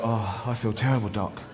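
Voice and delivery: a weak, sickly moan to the doctor; mock poorly patient, fading to nothing; feeble patient. Patient moaning to the doctor, weak and sorry for himself. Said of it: "you go".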